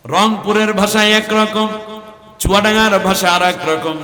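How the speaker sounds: high, loud sermon declaration; preaching at full force. Acted. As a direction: proclaim it to the gathering. (উচ্চস্বরে ঘোষণার সুরে ওয়াজ)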